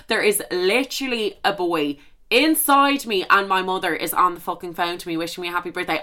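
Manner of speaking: ordinary talk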